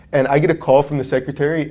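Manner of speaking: ordinary delivery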